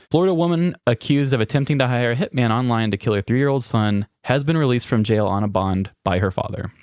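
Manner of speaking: normal talking voice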